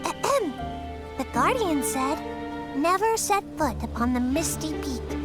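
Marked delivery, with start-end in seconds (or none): 0.0-0.5 theatrical "ahem"; throat-clearing. Pretend. Villager about to recite the Guardian's wisdom, clearing throat.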